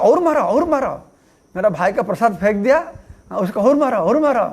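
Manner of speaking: high, swooping mock urging; egging-on god. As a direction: act out goading someone on to hit. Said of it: "बीच में जो पीला वाला बैठा है, वो बोलता था".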